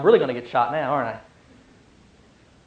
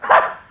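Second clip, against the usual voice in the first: incredulous ha; 5 dB louder than usual. Acted. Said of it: "is as incredulousness".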